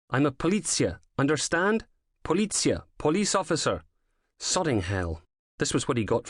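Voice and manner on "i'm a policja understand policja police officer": Policja stressed and sounded out as po-LEET-sya; earnest Polish attempt. A narrator's reading. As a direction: insist on Policja, pleading to be understood. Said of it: "doing his best to pronounce it right".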